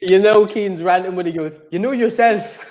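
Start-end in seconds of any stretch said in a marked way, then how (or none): 1.7-2.6 high, put-on Irish accent; mock Irish accent, pitched up; Irish accent impression. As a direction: mimic an Irish pundit's catchphrase in an Irish accent. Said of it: "that was an impression of an Irish accent".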